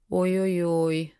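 slow, mildly wailing oh dear; lightly lament, drawing it out; sincere lament. A narrator's reading. Older friend feels responsible, sincerely lamenting. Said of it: "вполне искренне запричитала Настя".

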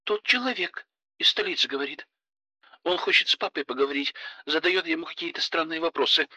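anxious. Acted. Frightened daughter pleads on phone.

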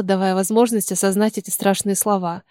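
plain reading voice

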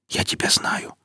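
urgent whispered recognition; whispering. Acted. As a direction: breathe it out, unvoiced. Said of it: "прошептал Никита".

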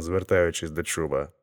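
usual speaking voice

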